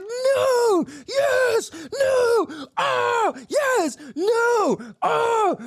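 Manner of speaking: very high, squealed back-and-forth exclamations; worked-up viewer reaction. Retold or imitated. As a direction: squeal each word like an overwrought fan. Acting out his emotional viewer reaction.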